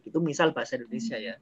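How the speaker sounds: ordinary delivery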